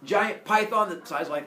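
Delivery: ordinary talk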